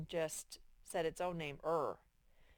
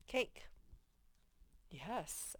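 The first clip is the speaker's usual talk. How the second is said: ordinary talk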